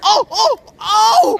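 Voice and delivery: alarmed oh oh oh; frightened yelps; startled cries. Real, not acted. Near crash on bike.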